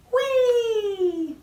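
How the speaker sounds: high, loud gleeful cry; whee. Acted. Announcing the song to children, gleeful high whee. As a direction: squeal it with delight.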